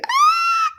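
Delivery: piercing very high screech; owl scream imitation. Retelling the owl video, imitates its piercing scream. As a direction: shriek like a screaming owl at night. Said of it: "a loud pitch scream, sound like a woman screaming".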